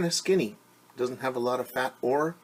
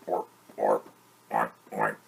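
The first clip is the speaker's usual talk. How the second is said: very low grunting pig voice; 13 semitones lower than usual. Acted. Storyteller grunts as the muddy pig.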